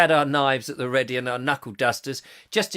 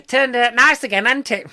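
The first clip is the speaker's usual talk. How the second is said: high, loud comic catchphrase voice; hammed-up music-hall comedian; 7 semitones higher, 7 dB louder than usual. Host imitating an old film comedian, high cheeky voice. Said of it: "the old [name] films".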